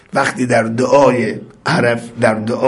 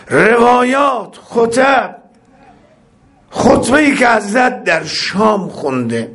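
preaching shout; 10 semitones higher than usual. Acted. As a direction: call out each item. Preacher listing the Imam's works.

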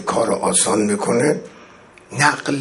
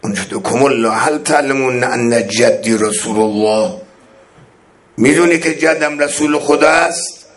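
ordinary talk, nothing marked

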